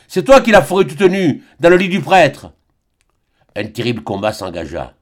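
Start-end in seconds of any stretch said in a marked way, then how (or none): 0.1-2.5 loud furious accusation; shouted. A narrator's reading. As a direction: keep yelling the charge. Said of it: "cria-t-il". (crié avec fureur)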